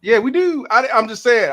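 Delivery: loud, high echo of agreement; high-pitched agreement. Real, not acted. Echoing the high agreement about accent.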